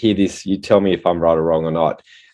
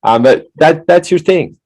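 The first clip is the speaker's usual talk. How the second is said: excited; 10 semitones higher, 6 dB louder than usual. Real, not acted. Host still laughing, praising guest.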